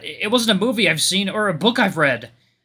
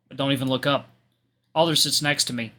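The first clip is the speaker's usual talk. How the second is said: ordinary delivery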